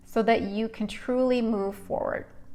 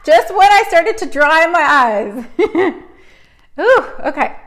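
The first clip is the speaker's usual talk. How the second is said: teary laughter, high and louder; laughing through tears; 7 semitones higher, 9 dB louder than usual. Real, not acted. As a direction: laugh while welling up again. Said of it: "I'm gonna cry again".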